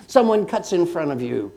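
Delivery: normal talking voice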